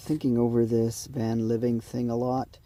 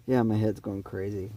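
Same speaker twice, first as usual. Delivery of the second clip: ordinary talk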